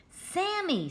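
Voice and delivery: high call of the name. Acted. Scooby calls after Sammy as he walks away. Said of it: "s'écrie Scooby".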